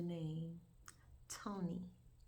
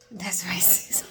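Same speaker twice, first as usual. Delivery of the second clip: laughing; 14 dB louder, slower than usual. Real, not acted.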